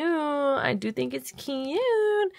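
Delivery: high, slow, stretched-out cute; slow sing-song cute; drawn-out cutesy. Shown as a demonstration. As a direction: coo it slowly like a baby voice. Repeats line slow and high.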